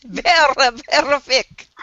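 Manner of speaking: hammed-up Scottish accent; mock Scottish accent. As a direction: lean into the Scottish accent for a joke. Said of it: "Sorry, I had to do it".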